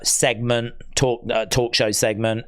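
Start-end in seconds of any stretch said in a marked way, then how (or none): none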